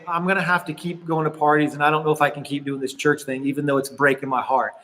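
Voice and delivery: retold tearful. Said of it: "she sat there crying".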